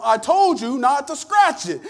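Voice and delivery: loud, high, slow scold; a mother snapping at her kid; scolding mom. Retold or imitated. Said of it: "brings a response from your mom".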